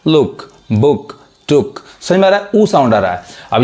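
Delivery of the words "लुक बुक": लुक and बुक drawn out slowly, each vowel a short oo; slow teacher demo of the oo sound. Shown as a demonstration. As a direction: stretch out लुक, बुक word by word. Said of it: "यानी लुक".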